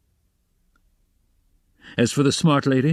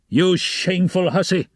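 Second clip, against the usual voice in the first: furious shout; 6 semitones higher than usual. A narrator's reading. Officer scolding a landlady before the clerks, furious shout. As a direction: roar it at her, outraged. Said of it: "he shouted suddenly at the top of his voice".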